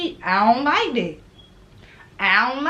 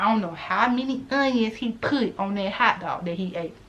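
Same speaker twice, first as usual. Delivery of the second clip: ordinary talk, nothing marked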